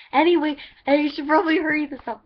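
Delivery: calling out to someone off camera to hurry up; loud call. Real, not acted. Shouted aside at someone off camera.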